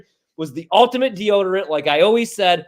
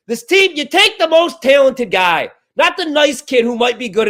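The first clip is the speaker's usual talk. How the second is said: higher, louder, heated rant; fired up; 5 semitones higher, 3 dB louder than usual. Real, not acted. Host ranting about draft picks, heated and loud. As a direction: pound the point home, heated. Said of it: "I'm all fired up".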